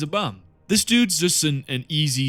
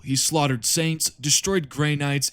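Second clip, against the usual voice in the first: usual speaking voice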